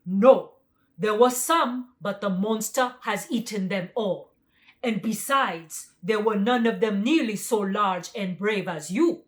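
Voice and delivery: loud tiger voice; the tiger, fearful yet flattering; tiger's voice. A narrator's reading. Tiger answers lion.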